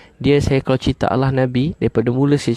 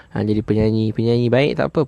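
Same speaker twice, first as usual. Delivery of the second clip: normal talking voice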